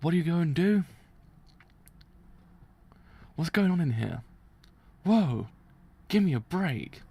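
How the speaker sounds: hammed-up shock and complaint; exasperated character voice. Voicing an exasperated manga character.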